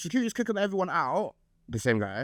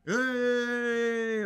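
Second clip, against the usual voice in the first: a high, bright call of "Hey!"; party cheer; 8 semitones higher than usual. Retold or imitated. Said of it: "We were literally like".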